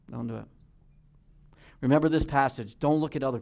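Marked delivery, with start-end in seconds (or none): 0.1-0.5 softer repeat. Real, not acted. Preacher repeats warning to congregation.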